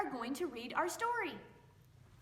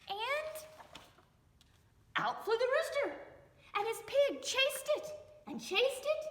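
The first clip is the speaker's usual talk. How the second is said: excited; 11 semitones higher than usual. A narrator's reading. Narrator describing the rooster escaping, excited and high. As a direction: let it burst out high.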